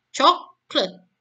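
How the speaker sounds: Chocolate said CHOK-let, stress on the first syllable; English Chocolate, CHOK-let; two-syllable English. Shown as a demonstration. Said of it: "dia hanya punya dua silabel".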